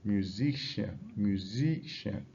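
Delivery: musician as mu-SI-cian, middle syllable drawn out; careful practice-word delivery of musician; stressed-syllable demo. Shown as a demonstration. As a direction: lean slowly on the SI of musician. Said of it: "practice with words like".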